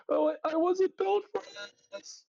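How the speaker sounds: very high comic wail; hammed-up helpless cry, dropping away at the end; mock distress. Mocks the hero going down.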